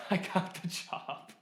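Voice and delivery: laughing at the memory. Real, not acted.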